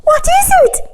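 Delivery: very high, loud courtier cry. A narrator's reading. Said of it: "cried all the courtiers".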